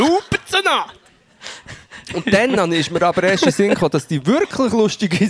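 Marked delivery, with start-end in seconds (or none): 0.0-0.9 high, excited show-title call; announcer shout. Pretend.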